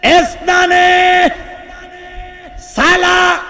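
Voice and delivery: very high, long-held counting calls; shouted count. Acted. Preacher playing Omar counting down a threat, high drawn-out shouts. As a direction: stretch each number into a high loud cry. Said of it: "হযরত ওমর বলতেছে".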